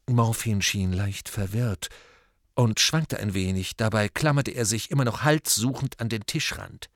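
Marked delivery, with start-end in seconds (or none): none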